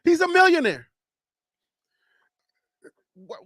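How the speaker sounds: normal talking voice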